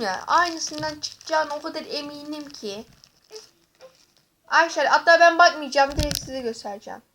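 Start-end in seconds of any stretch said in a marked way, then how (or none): none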